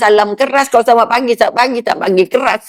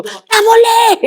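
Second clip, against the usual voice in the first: higher, sharp little no; curt refusal; 11 semitones higher, much slower than usual. Retold or imitated. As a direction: snap out a fussy refusal. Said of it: "Orang tu".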